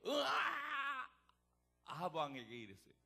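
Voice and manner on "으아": high, long roaring "aah"; loud battle cry. Acted. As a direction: let out a big, bold yell. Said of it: "처음에 큰 소리로 시작했단".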